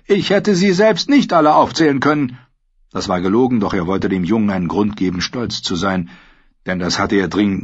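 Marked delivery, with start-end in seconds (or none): 0.1-2.4 high, loud, delighted praise; pleased lord praising his squire; amused praise. A narrator's reading. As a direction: congratulate the boy heartily. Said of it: "Tyrion lachte".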